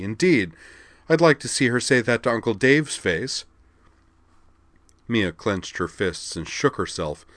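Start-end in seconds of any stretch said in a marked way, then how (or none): 0.0-3.5 angry. Acted. Mia fuming.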